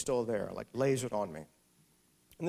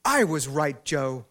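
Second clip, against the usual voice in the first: loud, higher imitated shout across a restaurant; retold scream; 4 semitones higher, 11 dB louder, slower than usual. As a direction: call out triumphantly to a husband far away. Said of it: "screams across the restaurant".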